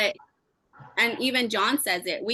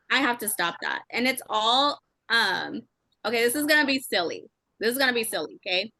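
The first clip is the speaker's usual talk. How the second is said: usual speaking voice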